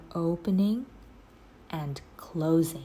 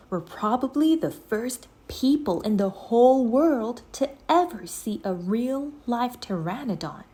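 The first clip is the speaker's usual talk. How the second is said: excited; 6 semitones higher, 5 dB louder than usual. A narrator's reading. Jack thrilled.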